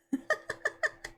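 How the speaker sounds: shrieking little laugh; high squealing laugh. Real, not acted. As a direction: let a giggle burst out high and squeaky. Bursts out laughing at chat.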